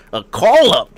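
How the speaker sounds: loud, high hype shout of "call up"; excited call-up hype. Shown as a demonstration. Hyping the surprise call-up, loud and high. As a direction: yell "call up" like a crowd pop. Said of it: "it's not exciting when I do it".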